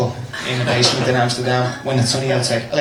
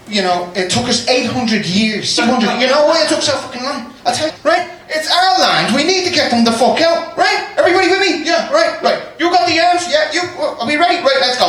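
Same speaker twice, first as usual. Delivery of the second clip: mock rebel rally; 11 semitones higher than usual. Comedian playing an Irish rebel rallying the crowd, hammed-up. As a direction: whip up the crowd like a rebel leader.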